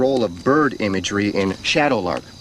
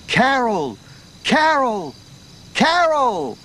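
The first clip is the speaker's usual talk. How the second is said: calling a name across crashing waves; 9 semitones higher, slower than usual. Acted. Voice on the beach calling for Carol. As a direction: cry the name out into the wind.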